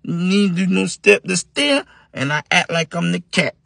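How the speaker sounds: high, very slow careful voice; slow brother. Acted. John repeating the plan to brothers, high and slow. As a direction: spell out the plan slowly like a simple kid. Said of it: "He said".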